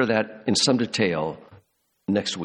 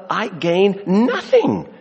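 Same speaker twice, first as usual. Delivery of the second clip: "nothing" hit higher and slow; stress on nothing; 8 semitones higher, 3 dB louder, much slower than usual. Shown as a demonstration. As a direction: stretch out "I gain nothing", rising high. Preacher stresses nothing again.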